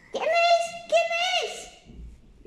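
very high piggy question; high piglets asking who's there; squeaky piglets. A narrator's reading. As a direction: squeak it like tiny pigs. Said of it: "los cerditos dijeron".

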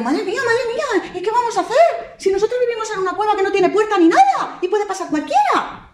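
high piglet voices, worried; squeaky fretful piglets; scared piglets. Acted. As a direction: piglets panicking about the wolf. Frightened piglets talk.

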